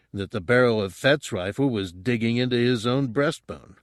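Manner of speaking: plain reading voice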